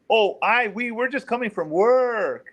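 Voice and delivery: high, drawn-out imitation of a suspect. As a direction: raise the voice and stretch it out as he did. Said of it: "he changed his voice".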